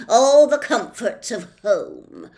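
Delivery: loud, slow mock-hospitable; sarcastic jailer's welcome; policeman. A narrator's reading. Policeman locking them in, loud and slow. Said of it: "said the policeman".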